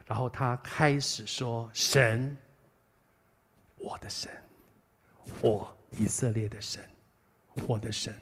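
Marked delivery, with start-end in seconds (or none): 1.7-8.1 soft, partly whispered prayer; hushed prayer. Retold or imitated. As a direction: breathe the words like an intimate prayer. Quoted prayer of the believer.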